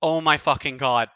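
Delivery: normal talking voice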